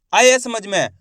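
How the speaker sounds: ordinary talk, nothing marked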